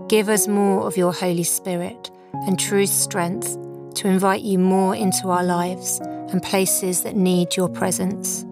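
ordinary talk

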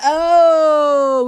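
high, loud, pained "oh"; sudden loud anguished oh; loud exasperated cry. Real, not acted. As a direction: let out a fed-up wail. Exasperated outburst.

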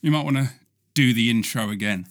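ordinary delivery

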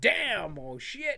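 mock curse. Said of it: "where you would normally be like".

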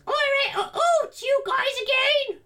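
put-on very high old woman voice; tavern lady voice. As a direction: falsetto innkeeper, weary. Tavern lady greets party.

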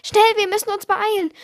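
breathless urgent hurry; panting. Acted. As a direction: gasp it out while running. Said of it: "keuchte Dorian".

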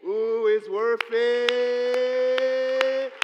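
very slow, loud, stretched praise; preaching it home slow and loud; drawn out. Real, not acted. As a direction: hold every word out long and strong.

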